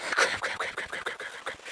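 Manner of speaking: rapid whispered crap, crap; frantic whisper. Acted. Realizing she hallucinated, she whispers to herself in panic. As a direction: hiss the words under your breath in panic.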